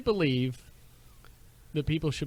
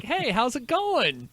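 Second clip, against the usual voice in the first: mock polite greeting; 9 semitones higher, 5 dB louder than usual. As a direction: sweetly wish them a great day. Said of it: "And it's like".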